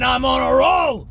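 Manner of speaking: shouted "roll" at full ranting pitch; Alex Jones shout. Acted. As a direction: burst out with it like the rant catching fire. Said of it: "my Alex Jones impression".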